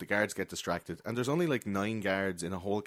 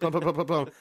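rapid popping shot noises, high and loud; imitated gunfire; 7 semitones higher, 5 dB louder than usual. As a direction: mimic a burst of shots with your mouth. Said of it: "he just goes like".

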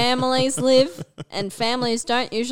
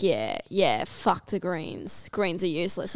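imitating dad; 4 semitones lower, 6 dB quieter than usual. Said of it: "he's like".

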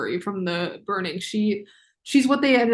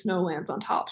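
softer, as a mysterious teaser; ominous quoted phrase; 3 semitones lower, 8 dB quieter than usual. Shown as a demonstration. Teasing viewers about what's coming, ominous hush. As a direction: drop the voice and let it hang. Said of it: "you'll understand the phrase".

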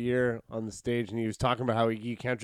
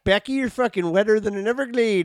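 higher, louder hammed-up American twang; mock American; 7 semitones higher, 5 dB louder than usual. Mocking American bedroom talk to Becky, loud American twang. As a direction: play the over-the-top American lover. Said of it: "That's, that's American".

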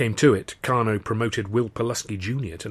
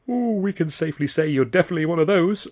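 flustered higher babble; babbling; 9 semitones higher, 5 dB louder than usual. Acted. Arthur, flustered by Wren's advance, babbles higher. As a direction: blurt it out in a nervous rush. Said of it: "I babbled".

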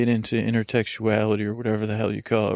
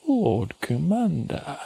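slow, higher ceremonial call of the title; drawn-out title call; 5 semitones higher, slower than usual. Acted. Calling the dog by his grand title, drawn out slowly. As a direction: intone it like a herald.

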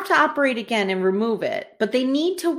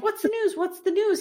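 eager demand; 9 semitones higher, 5 dB quieter than usual. Retold or imitated. Grace eager, retold scene.

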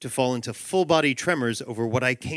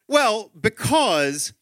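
drawn-out, higher, searching for an answer; stalling dad; 8 semitones higher, much slower than usual. Acted. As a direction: stretch each word while you think.

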